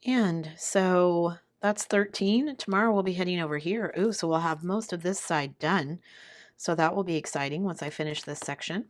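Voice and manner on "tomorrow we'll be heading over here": normal talking voice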